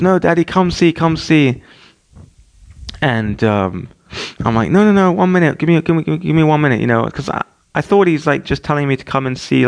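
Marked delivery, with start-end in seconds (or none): 0.0-1.6 higher, pleading little-kid imitation; lightly voiced toddler. Two-year-old calls Dad to come, lightly voiced toddler. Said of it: "And he goes". 4.7-6.8 higher, repetitive mild fending-off; retold hurried put-off. Busy dad to insistent toddler, retold hurried put-off.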